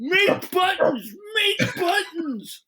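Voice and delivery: high, slow whiny shout; hammed-up toddler demanding buttons; mock kid yelling. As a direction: wail it like a frustrated kid. Kids slamming rotary phone.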